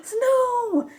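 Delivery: high, loud gasping cry of joy; excited exclamation. Shown as a demonstration. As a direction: shout snow like a thrilled child. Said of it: "With an exclamation point".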